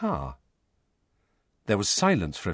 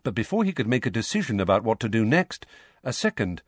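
usual speaking voice

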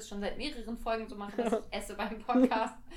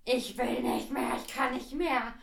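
fed-up whine; 4 dB louder, slower than usual. Retold or imitated.

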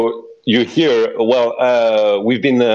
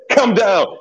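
raised, laughing call; 10 semitones higher than usual. Real, not acted. Calling to frantic player, laughing.

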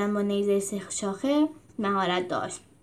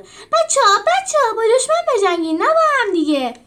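much higher, louder frantic cry; panicked plea; 12 semitones higher, 7 dB louder than usual. Acted. Cornelius pleading with his squabbling friends, panicked. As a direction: beg them to stop fighting each other.

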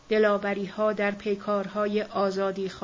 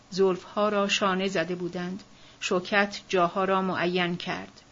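plain reading voice

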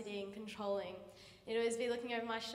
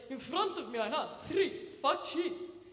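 put-on Argentinian accent; 2 semitones higher, 6 dB louder than usual. Relief science teacher addressing the front row, Argentinian accent. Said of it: "He was Argentinian".

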